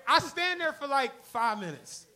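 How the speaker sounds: ordinary talk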